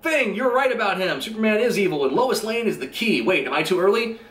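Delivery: hammed-up urgent time traveller; frantic Flash. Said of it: "he's like".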